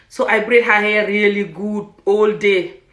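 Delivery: normal talking voice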